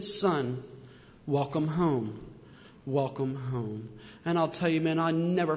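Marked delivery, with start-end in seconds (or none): 0.0-3.9 soft and low, slowing down on the repeat; a kneeling father gently welcoming his son home; tender fatherly welcome. Retold or imitated. Father kneeling to welcome his son home, soft and tender.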